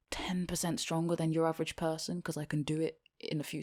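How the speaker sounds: aside in a whisper. Acted. Girl whispering a boast about pickle jars. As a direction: drop to a breathy aside. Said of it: "unvoiced whisper".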